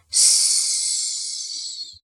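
long soft hiss of air; breath-like yes. A narrator's reading. Boy posing as a spirit assenting, in a puff of breath. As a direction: answer with only a fading breath. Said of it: "un sì che pareva un soffio".

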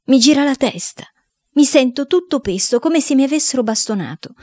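high-pitched lament; desperate. Acted. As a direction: complain in miserable despair. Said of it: "Sono disperato".

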